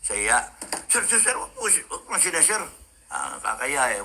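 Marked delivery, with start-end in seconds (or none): 0.9-2.7 embarrassed protest. Retold or imitated. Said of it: "hiyang-hiya ako eh".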